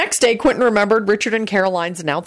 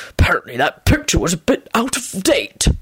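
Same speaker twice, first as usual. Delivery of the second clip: too-loud muttered aside; doubtful, put-on mutter. A narrator's reading. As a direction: grumble it at full volume. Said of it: "he muttered a bit too loudly".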